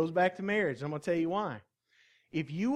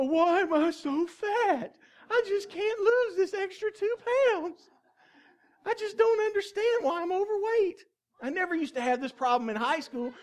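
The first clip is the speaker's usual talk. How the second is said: mock a moaning dieter with your voice pitched way up; put-on complaining voice; 10 semitones higher than usual. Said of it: "I default to voices like that".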